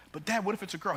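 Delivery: put-on high-pitched questioning kid; sixth-grader self. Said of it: "And I said".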